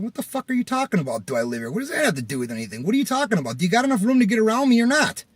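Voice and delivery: loud, high, irritated retort; frustrated. Retold or imitated. Driver challenges man blocking the alley, frustrated. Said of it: "obviously, I'm a little frustrated".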